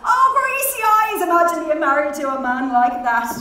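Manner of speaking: high, loud outcry, then rushing on; loud cry of horrified mirth. A narrator's reading. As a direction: exclaim high, then tumble down quickly. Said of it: "she cried".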